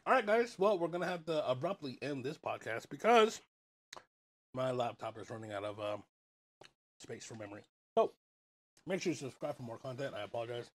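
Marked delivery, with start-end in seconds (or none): 4.5-10.7 chewing while talking; mouth full. Real, not acted. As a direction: talk through a mouthful. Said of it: "I'm chewing".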